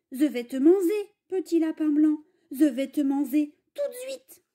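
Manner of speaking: lisp: "Je" said "ze", "manger" said "manzé"; "Je vais te manger" lisped with z for j; lisping wolf. Acted. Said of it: "t'as un cheveu sur la langue".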